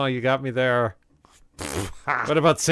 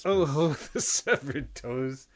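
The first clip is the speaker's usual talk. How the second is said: mock creepy voice; 3 semitones higher, 5 dB quieter, slower than usual. Ghoulish Christmas story voice.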